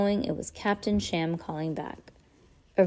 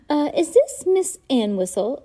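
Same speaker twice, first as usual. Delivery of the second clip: higher, louder put-on wheezy villain voice; 8 semitones higher, 7 dB louder than usual. A narrator's reading. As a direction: disguise your voice, wheezy and sly. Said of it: "a wheezy voice asked".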